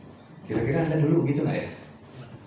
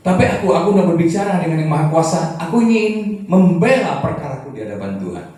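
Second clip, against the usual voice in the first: loud, high, forceful reading of Job's words; preaching Job's complaint; 7 semitones higher, 10 dB louder than usual. Acted. Preacher voicing Job's protest to the congregation, loud and high.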